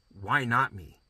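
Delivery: ordinary talk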